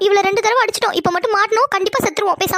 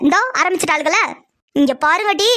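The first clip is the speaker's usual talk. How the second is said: normal talking voice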